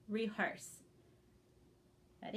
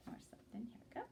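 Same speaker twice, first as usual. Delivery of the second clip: whisper; 16 dB quieter, much faster than usual. Real, not acted.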